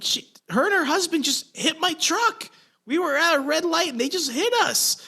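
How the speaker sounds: pleading his case in a rush; retold frantic protest. To the cop who yelled at him, a high heated protest. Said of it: "I look at the cop and I'm like".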